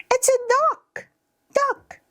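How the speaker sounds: put-on very high-pitched child answering; squeaky small kid naming an animal; little child.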